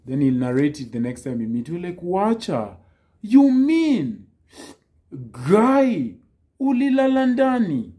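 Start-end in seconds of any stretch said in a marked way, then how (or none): 2.0-7.9 high, loud incredulous cry; mock disbelief. Reacting to a friend's crazy story with loud mock disbelief. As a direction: act stunned at the friend's story.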